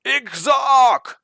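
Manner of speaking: high, loud battle cry in an alien tongue; sudden loud shout of alarm; warriors shouting. Acted. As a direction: bellow it like a panicked warrior. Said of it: "the Rakas shout".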